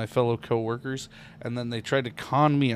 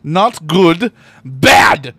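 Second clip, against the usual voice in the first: much louder playful scolding; 5 semitones higher, 16 dB louder, much slower than usual. Acted. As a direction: shout it like a mock scold. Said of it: "scolding reply to good".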